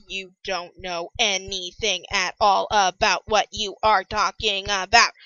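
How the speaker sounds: slow, one syllable at a time; staccato. Retold or imitated. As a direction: hammer out every syllable separately. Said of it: "I'm going all staccato".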